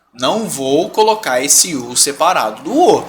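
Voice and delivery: ordinary delivery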